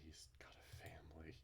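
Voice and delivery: soft, breathy inner thought; a hushed private realisation; whisper. A narrator's reading. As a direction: whisper it to yourself in shock. Reading a character's inner thought.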